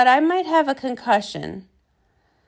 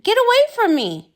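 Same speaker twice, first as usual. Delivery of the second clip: higher, louder panicked cry; frightened shout; 7 semitones higher, 4 dB louder than usual. Acted. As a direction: push the plant away in alarm. Plant attacks for the potion.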